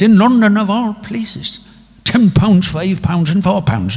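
high, bright Irish brogue; Irish accent. Retold or imitated. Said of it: "the Irish accent".

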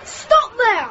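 high-pitched cry of alarm; urgent calling out. Acted. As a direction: call out a warning in a hurry. Children flee approaching bully.

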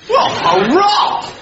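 high, drawn-out hurrah; jubilant cheer. Acted. Lieutenant cheering news of the Russian Revolution, jubilant. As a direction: cheer it heartily, slowly and high.

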